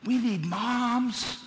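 ordinary talk

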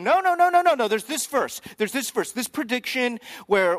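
high, loud, rapid-fire objection; emphatic correcting. Acted. Crowd group arguing back over a prophecy, emphatic correcting. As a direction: jump in loudly to set them straight. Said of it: "another group corrects them".

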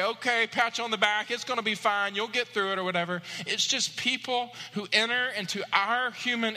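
impassioned pulpit voice; preaching. Acted. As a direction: build to the heart of the sermon. Preaching climax.

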